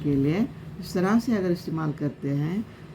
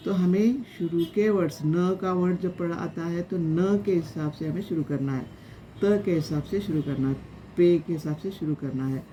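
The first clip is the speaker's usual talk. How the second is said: ordinary talk, nothing marked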